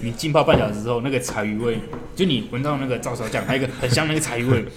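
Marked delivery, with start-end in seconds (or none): none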